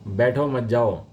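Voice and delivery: usual speaking voice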